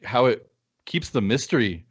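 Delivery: normal talking voice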